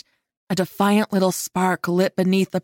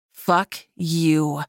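growled; 2 semitones lower than usual. A narrator's reading. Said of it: "I growled".

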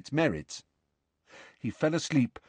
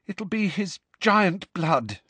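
shaky; 9 semitones higher, slower than usual. A narrator's reading. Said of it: "said Hermione shakily".

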